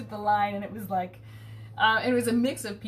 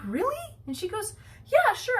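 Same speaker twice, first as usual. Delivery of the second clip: mock surprise; 12 semitones higher than usual. Retold surprise.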